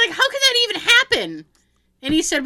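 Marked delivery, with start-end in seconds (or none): 0.2-1.4 high, pitched-up disbelief; how could that happen, voice jumping up high; incredulous. Retold or imitated. Retelling the drive-thru mixup to a friend, high incredulous voice.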